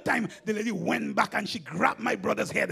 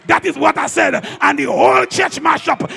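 ordinary delivery

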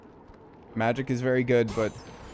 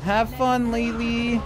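excited high cheer; 10 semitones higher, slower than usual. Real, not acted. Cheering the character on.

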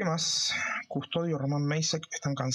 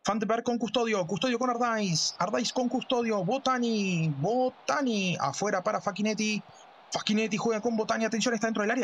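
play-by-play commentary; 6 semitones higher than usual. Acted. Football play-by-play.

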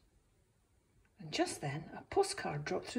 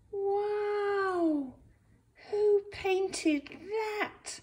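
amazed; 14 semitones higher, 6 dB louder, much slower than usual. Acted. Bob sees the sunrise.

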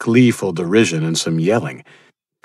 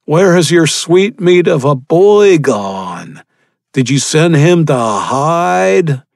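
louder, higher, slow sneering villain; mocking cannibal; 9 semitones higher, 6 dB louder, slower than usual. Cannibal leader taunts the father, sneering and loud. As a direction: toy with your victim.

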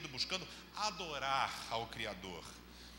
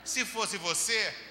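normal talking voice

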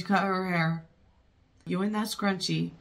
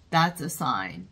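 usual speaking voice

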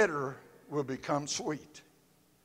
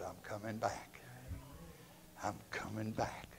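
hushed whisper; 6 semitones lower, 17 dB quieter than usual. Acted. God's promise retold hushed.

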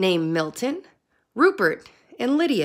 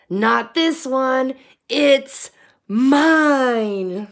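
slow, loud, defiant croak; refuse, holding the worm tight; 3 semitones higher, 6 dB louder, much slower than usual. A narrator's reading. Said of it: "Milton croaked defiantly".

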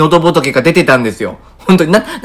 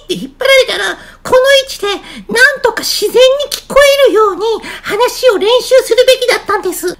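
sustained very high falsetto; raised voice position. Shown as a demonstration. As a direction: demonstrate talking from a pulled-up pitch.

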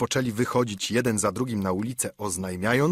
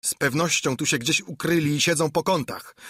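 pirate grumbling; 5 semitones higher than usual. A narrator's reading. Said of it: "bąknął zbójca".

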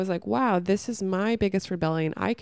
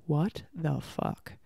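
slow, low, appalled disbelief; 3 semitones lower, 4 dB quieter, much slower than usual. Retold or imitated. Reacting to the sex-education videos she watched, appalled disbelief. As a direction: draw it out, stunned by what you've seen.

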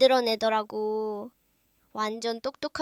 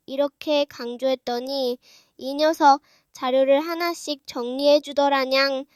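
ordinary talk, nothing marked

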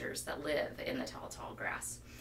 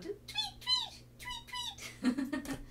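high chirpy bird call; birdy tweet; slower than usual. Shown as a demonstration. Teacher chirping a bird call for kids.

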